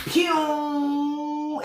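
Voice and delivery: mock fart whoosh. Trump taking off by fart.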